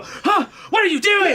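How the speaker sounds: high, loud, startled demand; startled shout. Acted. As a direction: burst out with a high-pitched yell. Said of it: "I come out and I go".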